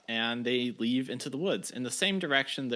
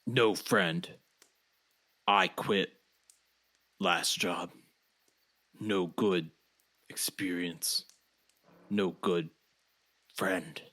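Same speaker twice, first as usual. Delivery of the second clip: lower, slow broken-English voice; simple Bobby; 6 semitones lower, slower than usual. Acted. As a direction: speak like simple-minded Bobby. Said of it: "and Bobby says".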